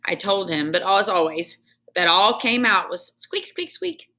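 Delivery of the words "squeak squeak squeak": tiny high whispered squeaks; hamster squeak. Acted. Hamster trying to talk to the janitor.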